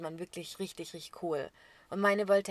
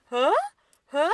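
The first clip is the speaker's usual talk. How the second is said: imitating; 8 semitones higher, 11 dB louder than usual. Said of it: "hat immer".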